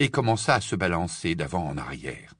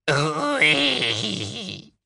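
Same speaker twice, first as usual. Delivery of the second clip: high strained elf voice; a cracked reluctant yes from the old elf; Kreacher, grudging; 8 semitones higher than usual. Acted. Kreacher answers Harry about the locket, croaking.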